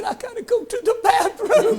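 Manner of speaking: put-on high, pleading young girl; girl's pleading voice. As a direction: squeak it out like a desperate girl.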